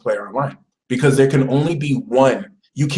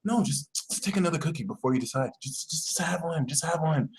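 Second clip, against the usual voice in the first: soft, hurried, hushed urging; intense coaxing; 11 dB quieter than usual. Acted. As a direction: press the temptation quietly. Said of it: "Scorpio will flare their eyes".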